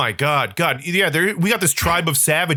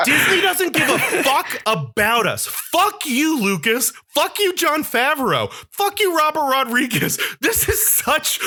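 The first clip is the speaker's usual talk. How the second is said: high, heated cursing at the makers; furious rant; 5 semitones higher than usual. Real, not acted. Host ending his Star Wars rant, furious and high. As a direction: spit the curses out in outrage. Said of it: "End of rant".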